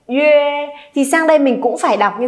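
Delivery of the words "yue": "yue" held out as ü gliding into e; teacher's drawn-out "yue"; model yue. Shown as a demonstration. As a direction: say "yue" with rounded ü, then open to e. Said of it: "âm này là yue".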